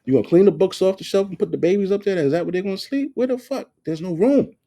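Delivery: high, fast sarcastic questions; mock her plan with disbelief; high sarcastic retort to the ex-wife; sarcastic rant. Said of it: "'Cause she had bookshelves".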